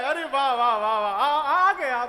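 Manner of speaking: mocking sister's overdone praise; sarcastic welcome. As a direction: drip sarcasm on the wah wah. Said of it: "ये व्यंग है".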